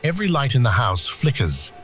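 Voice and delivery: usual speaking voice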